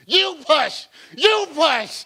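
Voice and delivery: high, loud pushing coach; cheering on a pregnant woman, loud and high; mock labor coach. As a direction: shout it like a midwife urging her on.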